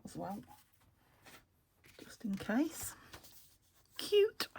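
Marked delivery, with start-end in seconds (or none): 4.0-4.5 delighted squeal. Real, not acted. Crafter delighted by an item.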